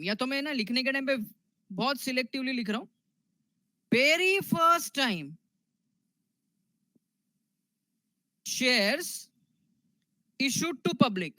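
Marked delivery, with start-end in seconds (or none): 3.9-11.3 drawn-out words with long pauses; slow dictation. Real, not acted. Teacher dictating a definition to students, slow dictation. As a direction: dictate phrase by phrase, leaving time to write.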